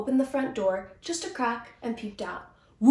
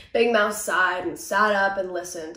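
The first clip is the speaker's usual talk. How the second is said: ordinary delivery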